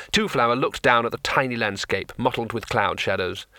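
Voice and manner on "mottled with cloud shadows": ordinary delivery, nothing marked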